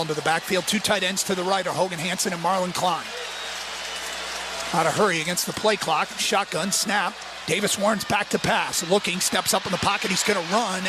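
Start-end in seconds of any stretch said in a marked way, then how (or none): none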